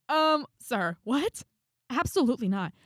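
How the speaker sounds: high, loud mock indignation; affronted disbelief. Student balking at professor sitting next to her, affronted.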